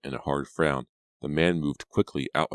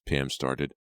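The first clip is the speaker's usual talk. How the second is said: ordinary delivery, nothing marked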